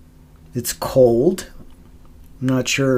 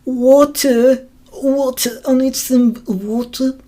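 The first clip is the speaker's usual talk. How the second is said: "Water" said high and posh British, "wɔtu" with no r; hammed-up British "water, water"; mock British accent; 11 semitones higher, 4 dB louder, slower than usual. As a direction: put on a fancy British accent, higher. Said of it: "the fancy way of saying".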